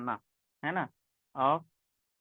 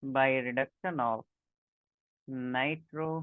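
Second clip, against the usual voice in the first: very slow, every word spelt out for notes; slow dictation; much slower than usual. Shown as a demonstration. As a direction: say it slowly so students can write it down. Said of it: "dictating to class".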